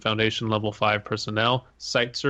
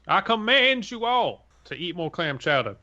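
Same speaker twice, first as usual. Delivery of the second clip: mock grand proclamation; 10 semitones higher than usual. Mock cult leader decreeing clam chowder to his followers. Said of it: "I like "in the name of".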